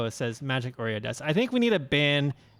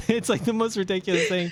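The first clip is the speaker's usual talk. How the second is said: laughing; 6 semitones higher than usual. Real, not acted. Sister's boyfriend's jumpsuit, amused.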